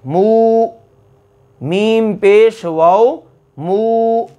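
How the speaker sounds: मू with the long u held; long madd demo. Shown as a demonstration. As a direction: stretch the vowel in मू. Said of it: "ये वाव मद्दा है।".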